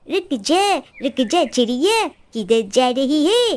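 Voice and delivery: slow, threatening halt; menacing crow. Acted. Crow stops the sparrow on the path, slow and menacing. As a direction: block her way, drawn out.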